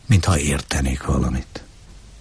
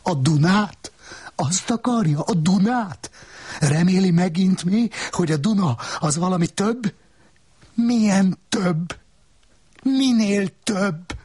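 much higher, taunting woman's voice; high-pitched scornful woman taunting the man. Acted. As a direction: needle him in a high, mocking voice. Woman character's mocking questions.

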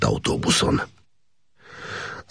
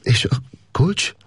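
higher, slow and tight with dread; choked voice; 11 semitones higher, slower than usual. A narrator's reading. Gábor senses trouble and asks for the key, choked. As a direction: ask it with a lump in the throat. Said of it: "rosszat sejtve elszorult hangon".

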